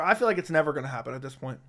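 ordinary talk

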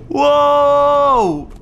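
very slow, loud wow; stretched wow. Real, not acted. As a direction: draw the wow out in awe. Amazed exclamation. (واو ممطوطة بدهشة)

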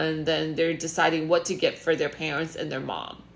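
usual speaking voice

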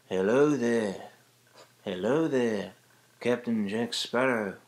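slow whispery Jack Sparrow greeting; breathy pirate hello, lightly voiced; Jack Sparrow whisper. Shown as a demonstration. Tutor demonstrating the Johnny Depp Jack Sparrow voice. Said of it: "it's a lower whisper".

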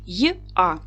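"Й-а" said as two separate sounds, й then а; sounds pulled apart. Shown as a demonstration. Teacher showing the two sounds of the letter Я. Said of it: "м'який приголосний і голосний".

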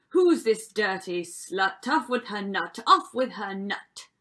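loud, forceful prince; crying out angrily. A narrator's reading. Prince ordering Cinderella's execution, angry cry. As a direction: bellow the order as a tyrant prince. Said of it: "The prince cried".

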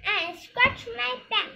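high, loud little dwarf voice; itchy dwarf. A narrator's reading. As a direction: demand it high and loud, as if itching. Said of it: "says the itchy dwarf".

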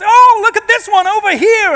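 high, loud mock tourist exclamation; American tourist lady. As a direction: imitate an excited American tour guide. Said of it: "a group of American tourists came round the corner".

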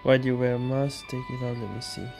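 normal talking voice

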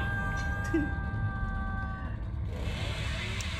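high unvoiced burst of laughter; real airy laugh; breathy laugh. Real, not acted. Laughs at annoying players.

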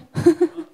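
loud, high burst of laughter; short laugh. Real, not acted. Teacher answering a student about TOEFL, short loud laugh.

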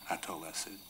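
ordinary talk, nothing marked

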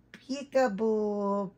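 very slow, high sing-song peekaboo; doll's peekaboo. Pretend. Mom voices the doll playing peekaboo, slow and sing-song. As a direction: draw out peekaboo like a baby's game.

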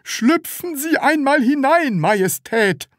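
high, loud seller's voice; strained politeness. A narrator's reading. Seething seller invites the customer in, strained politeness. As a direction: force out courtesy at high pitch.

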